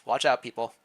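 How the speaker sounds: plain reading voice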